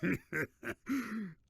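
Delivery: short high mocking laugh; scoffing laugh. Villain laughs at the accusation, scoffing.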